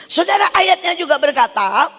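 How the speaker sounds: high, loud call to the crowd; preaching call. Real, not acted. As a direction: lift the voice over the hall. Preacher calls out to congregation.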